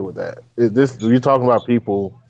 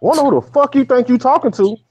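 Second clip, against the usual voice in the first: confronting; 12 semitones higher than usual. Retold or imitated. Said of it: "looked at that bitch and said".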